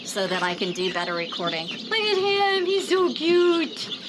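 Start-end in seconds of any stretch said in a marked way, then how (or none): 1.7-4.0 cooing. Real, not acted. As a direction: gush over the chick, high and slow. Cooing over a chick.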